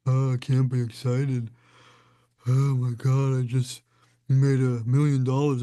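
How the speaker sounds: mock a sulky person grumbling at the speaker; whiny complainer. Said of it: "Some people hear it and say".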